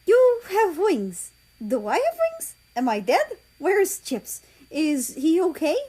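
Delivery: high, rushed bewildered exclaiming; high anxious exclaiming; frantic questions. Acted. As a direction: pile up the questions in panic. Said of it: "Russia exclaimed".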